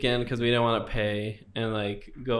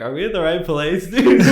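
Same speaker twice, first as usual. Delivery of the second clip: higher and louder, breaking into a laugh; laughing disbelief; 6 semitones higher, 9 dB louder than usual. Retold or imitated. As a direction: friends baffled in the bleachers, half laughing. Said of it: "my friends are looking at me like".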